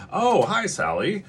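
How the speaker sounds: high, lilting mock-surprised greeting; pretend pleasant surprise. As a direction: greet the child as if just noticing her. Feigned sudden notice of friendly child.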